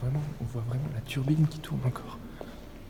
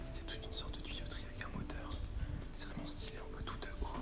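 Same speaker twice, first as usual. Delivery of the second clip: sneaking through a warehouse whisper; hushed, careful exploring voice; 8 dB quieter than usual. Real, not acted.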